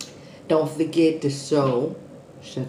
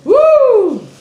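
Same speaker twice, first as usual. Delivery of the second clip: loud, high whoop of delight; excited woo; 14 dB louder than usual. Real, not acted. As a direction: let out a big happy whoop. Excited shout after a bite.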